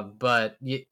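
ordinary talk

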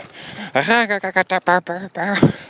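high snickering Beavis-style cackle; Beavis laugh. Retold or imitated. Father imitating his sugared-up son's Beavis laugh. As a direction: imitate a goofy cartoon snicker. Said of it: "like Beavis".

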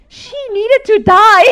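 high, loud, slow punchline; emphatic mock declaration. Husband justifying the dunking, loud emphatic retort. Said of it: "afterwards he says".

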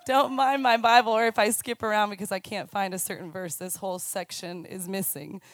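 ordinary talk

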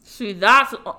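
talking through a mouthful; loud words round a bite; mouth full. Real, not acted. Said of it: "Don't talk with your mouth full".

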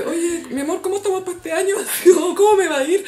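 high, laughing mock-sweet asking; lightly voiced husband wheedling; pleading husband. Scene: husband begging his witch wife for a forecast, wheedling. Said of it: "al otro año le dice".

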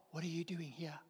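breathy whisper of God's question. Acted. Preacher voicing God asking the congregation, whispered. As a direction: whisper it as if inside their heart.

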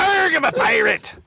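high hammed-up pirate Arg; pirate growl. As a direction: belt a cartoon pirate line. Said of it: "you yelled out".

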